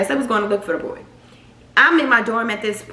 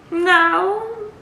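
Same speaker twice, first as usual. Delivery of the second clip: a short no, pitched much higher; retold flat no; 11 semitones higher than usual. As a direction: toss off a quick high no. Her own reply, retold.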